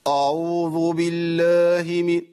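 chanted أعوذ with a thick, dark opening a; faulty recitation, أعوذ opening on a backed aa; wrong heavy hamza. Shown as a demonstration. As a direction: slowly intone أعوذ with a heavy first vowel. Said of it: "هي آ مش آ".

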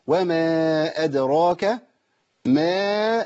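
slow chant of "وما أدراك ما", long vowels; recited madd. Shown as a demonstration. Teacher showing the alif madd, "ما" chanted long. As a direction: draw out each aa in "وما أدراك ما". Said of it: "ألف مادية تمد مقدار".

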